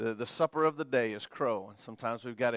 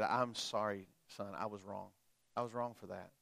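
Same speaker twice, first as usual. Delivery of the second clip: low, soft retold apology to his son; apologetic dad; 6 semitones lower, 11 dB quieter than usual. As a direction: quietly own up, as the father. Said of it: "my dad apologized to me".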